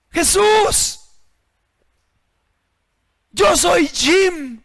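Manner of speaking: high, loud slow cry to Jesus; loud drawn-out calling out. Acted. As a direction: call into the empty church. Levantó la voz y dijo.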